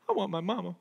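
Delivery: low, soft mock pout; sulky homesick whimper. Mark with his head down in jail, sulky mock pout. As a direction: mumble it like a little boy giving up. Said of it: "put his head down and be like".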